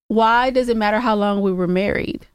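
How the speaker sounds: usual speaking voice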